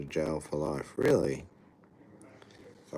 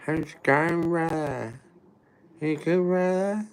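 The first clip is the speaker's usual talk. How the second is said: high, slightly louder mock greeting; impression of the greeter; 11 semitones higher, 4 dB louder than usual. Shown as a demonstration. Doing the strangers' greeting again, high mock voice. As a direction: pitch up high like a pushy street greeter. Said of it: "I can do it perfect".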